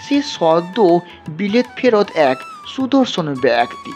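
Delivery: usual speaking voice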